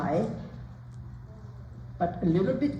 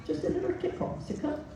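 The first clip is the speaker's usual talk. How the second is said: higher, softer coaxing cue to the horse; 6 semitones higher, 4 dB quieter than usual. Shown as a demonstration. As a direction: coax the horse lightly upward. Coach cueing the horse softly.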